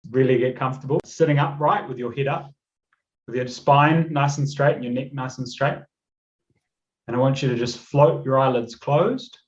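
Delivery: calm, unhurried meditation voice; measured relaxation-coach delivery; guided meditation. Acted. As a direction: guide them gently into the visualisation, leaving long pauses. Leads breathing and visualisation.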